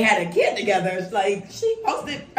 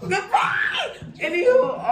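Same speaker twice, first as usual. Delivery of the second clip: laughing; 10 semitones higher, slower than usual. Real, not acted. Laughing it off, moving on.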